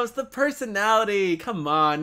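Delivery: normal talking voice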